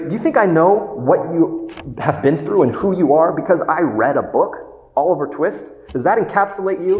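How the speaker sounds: loud, high, rising indignation; heated challenge. Retold or imitated. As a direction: build the challenge to a peak.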